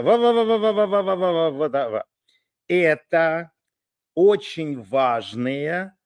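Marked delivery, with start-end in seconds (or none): 0.0-2.0 imitating garbled wah wah chatter; mock muffled soldier babble; muffled wah wah talk. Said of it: "Вот это".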